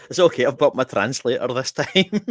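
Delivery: loud, amused, laughing through the words; laughing retort. Real, not acted. Customer returning with translator, laughing loud reassurance. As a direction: deliver the comeback while cracking up.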